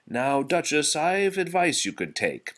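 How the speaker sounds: a worried cook offering advice; cook's voice. A narrator's reading.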